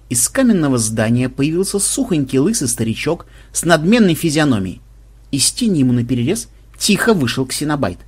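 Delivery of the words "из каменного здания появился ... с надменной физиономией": ordinary delivery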